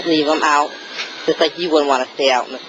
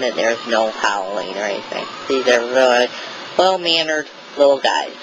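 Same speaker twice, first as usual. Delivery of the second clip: normal talking voice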